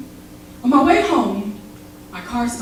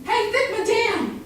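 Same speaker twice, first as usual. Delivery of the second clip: higher, mock catcall from a street guy; retold catcall, pitched up; man catcalling; 9 semitones higher, slower than usual. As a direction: call out like a pushy guy on the street. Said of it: "He was just yelling at me".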